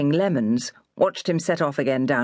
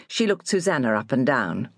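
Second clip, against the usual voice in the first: usual speaking voice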